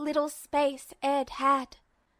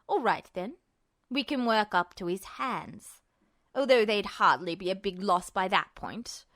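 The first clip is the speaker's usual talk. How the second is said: cold villain; 5 semitones lower than usual. A narrator's reading. Justin coldly cutting off the captive Ed. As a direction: cut him off, ice cold.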